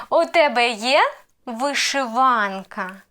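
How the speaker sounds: є rising sharply, вишиванка slow and falling; question intonation. Shown as a demonstration. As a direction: lift є, then settle down on вишиванка. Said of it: "the main role plays intonation".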